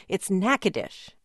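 Natchitoches as NACK-uh-dish; corrected pronunciation. Shown as a demonstration. Host correcting his own mistake, local pronunciation. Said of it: "where I got in trouble".

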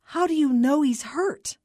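firm clerk pressing a frantic caller; demanding. A narrator's reading. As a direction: demand an answer through the chaos. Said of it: "demanding order from the chaos".

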